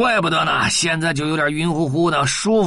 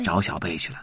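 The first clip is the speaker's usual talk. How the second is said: plain reading voice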